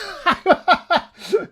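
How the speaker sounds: very high, loud burst of laughing; shrieking laughter. Real, not acted. Streamer recalling the excited swear, high loud laughter. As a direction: explode laughing at yourself.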